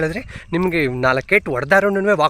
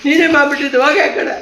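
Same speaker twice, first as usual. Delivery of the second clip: laughing; 9 semitones higher, 3 dB louder than usual. Real, not acted. Laughing, pushing dog away playfully.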